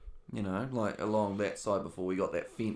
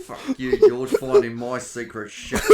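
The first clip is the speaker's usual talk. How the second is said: laughing dog voice; 2 semitones higher, 14 dB louder, slower than usual. Retold or imitated.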